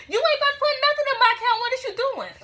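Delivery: very high, loud, fast retold outburst; outraged yell. Woman refusing the thief her account, outraged yell. Acted quarrel at the ATM.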